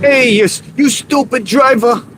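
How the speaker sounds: high-pitched jokey road rage yell. Shown as a demonstration. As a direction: shout up high at a driver. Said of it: "You guys like my road rage voice?".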